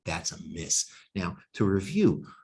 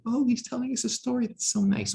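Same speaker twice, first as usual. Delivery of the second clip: much higher, fast, sugary falsetto; mock audience voice. Lecturer mimicking the audience's reaction to a story, high falsetto mockery. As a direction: squeal it like a delighted child hearing a story.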